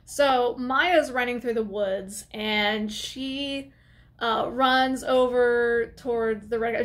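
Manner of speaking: normal talking voice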